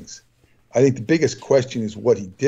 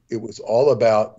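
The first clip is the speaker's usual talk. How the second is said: normal talking voice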